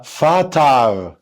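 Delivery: فطر stretched very slowly, every sound clear; slow model pronunciation. Shown as a demonstration. Teacher saying the German word for the child to repeat, slow. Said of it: "قول معايا".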